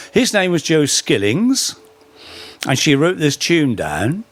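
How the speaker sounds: ordinary talk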